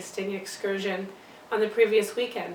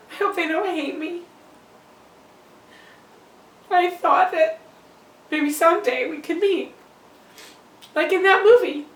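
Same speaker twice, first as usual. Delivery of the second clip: high-pitched, swelling to loud; distressed pleading; 7 semitones higher, 7 dB louder than usual. Acted. Birth mother hopes for a meeting, high and distressed. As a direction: let the hope rise high and spill out. Fears her child's family hates her.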